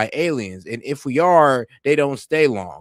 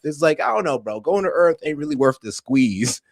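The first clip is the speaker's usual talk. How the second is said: normal talking voice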